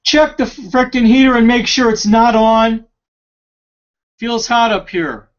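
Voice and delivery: high, loud, irritated call about the heater; shouting across the house. Real, not acted. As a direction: bark it so Ricky hears you downstairs.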